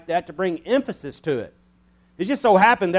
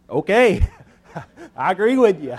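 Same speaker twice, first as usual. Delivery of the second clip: laughing agreement; 2 semitones higher, 5 dB louder than usual. Retold or imitated. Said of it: "I'm like".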